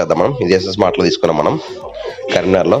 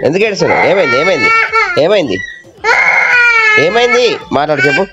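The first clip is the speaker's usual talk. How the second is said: higher-pitched, insistent questioning of a little one; 8 semitones higher than usual. Real, not acted. Parent asks crying child what's wrong, high-pitched coaxing.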